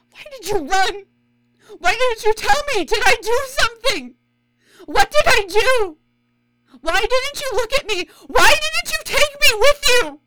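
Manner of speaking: building to loud, raw yelling of questions; woman shouting her pain at the man who left; anguished shouting. Acted. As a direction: let the hurt break into a yell. Confronting lost first love.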